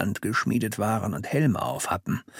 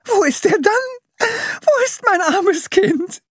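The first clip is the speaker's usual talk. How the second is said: distraught mother; 4 dB louder, slower than usual. A narrator's reading. Mother despairing for lost child.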